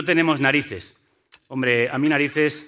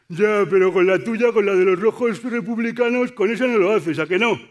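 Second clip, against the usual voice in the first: voz puesta, más aguda y lenta; objetor provocador; 5 semitones higher, 4 dB louder than usual. Retold or imitated. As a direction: imita al que te reta con retintín. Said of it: "el otro que me dirá". (voz fingida de un criticón que desafía)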